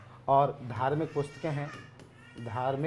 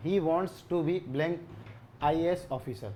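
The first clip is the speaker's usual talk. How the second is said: the sentence drawn out very slowly; slow dictation; much slower than usual. Shown as a demonstration. Teacher reading out question five, very slowly. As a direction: read it out slowly for the class. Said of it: "question number five में दिया गया है".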